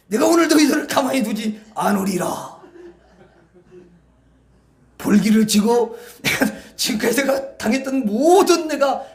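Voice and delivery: rising from loud gloat to a full vengeful shout; preacher voicing Joseph's imagined revenge, building to a shout. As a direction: swear revenge on your brothers, building to a roar. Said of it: "이렇게 속으로 생각을 하지".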